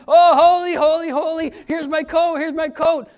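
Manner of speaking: high, loud mock adulation; excited crowd. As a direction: gush like a fawning crowd. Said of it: "the crowd was there going".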